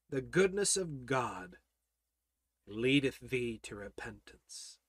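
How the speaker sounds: low, very soft, with long pauses; hushed preaching. Real, not acted. Preacher telling the congregation how to overcome depression, hushed preaching. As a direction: drop right down and let each phrase settle.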